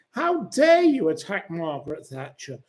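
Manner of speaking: ordinary talk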